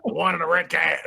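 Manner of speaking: comic voice for a talking dog. Retold or imitated. Dog repeating its food order, talking dog. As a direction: put on a dog character's voice. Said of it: "the dog's like".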